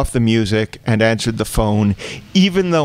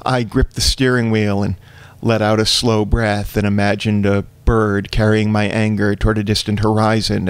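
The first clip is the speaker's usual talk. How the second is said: ordinary talk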